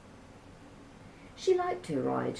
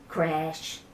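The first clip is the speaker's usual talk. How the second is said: plain reading voice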